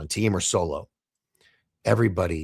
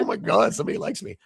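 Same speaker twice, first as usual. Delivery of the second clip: put-on higher, breathless gush of a pent-up teen; giddy teenager; 12 semitones higher than usual. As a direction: squeal it like a kid who just got noticed. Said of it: "pent up high school".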